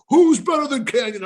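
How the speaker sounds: high, loud wrestling promo shout; imitating Kanyon's boastful cry; Kanyon's catchphrase. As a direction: hype it up like a heel on the mic. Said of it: "And Kanyon's like".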